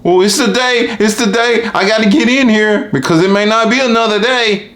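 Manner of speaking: high, eager patient voice. Retold or imitated. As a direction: play an anxious patient rushing to the appointment. Patient voiced rushing to doctor.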